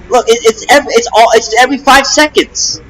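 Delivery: high-pitched, agitated complaint; exasperated outburst. Real, not acted. Rapper venting about people bothering him nonstop, high agitated outburst. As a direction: voice climbs up in frustration.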